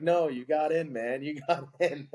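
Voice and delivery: high-pitched, breaking into laughter; retold good news, tipping over into a laugh; laughing reveal. Coach telling the recruit he got in, laughing.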